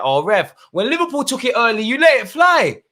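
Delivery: rising to a loud, high complaint; outraged at the ref. Real, not acted. Fan shouting at the referee on TV, building angry.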